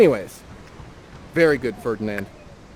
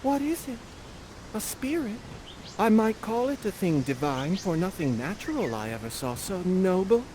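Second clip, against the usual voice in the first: put-on high, breathless wondering girl, much higher; Miranda in awe; 5 semitones higher, 3 dB quieter than usual. Host voicing Miranda meeting Ferdinand, high and awed. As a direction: gaze up at an angel. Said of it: "you say".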